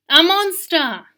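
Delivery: loud, high burst; spring it on them; storyteller's big monster reveal; dramatic reveal. A narrator's reading.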